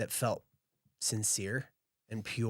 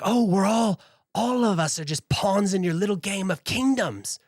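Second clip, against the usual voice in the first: high, loud, bitter accusation; bitter outburst; 9 semitones higher, 9 dB louder than usual. Acted. Silva accusing Marcus before storming off, loud and high. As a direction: spit the words at him in hurt fury.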